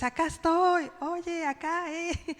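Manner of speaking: mock calling to someone far off; calling for attention. Waving down someone who hasn't seen you, calling out lightly.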